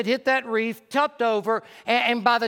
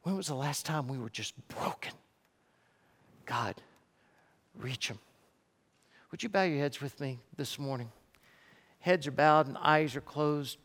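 soft, low altar-call voice; quiet, solemn preacher with long pauses; hushed invitation to prayer; 7 semitones lower, 7 dB quieter than usual. Real, not acted. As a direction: gently lead bowed heads into prayer, unhurried. Prayerful invitation, heads bowed.

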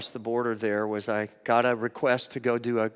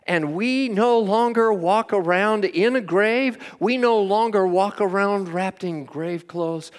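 raised, drawn-out preacher's proclamation; full-force preaching; 6 semitones higher, 5 dB louder than usual. Real, not acted. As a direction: preach it high and slow, ringing out to the congregation.

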